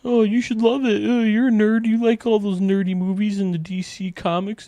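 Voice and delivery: high, put-on voice mocking fans; mock teasing voice of a fan; mocking imitation. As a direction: ham up a condescending fan. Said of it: "mocking people who love it".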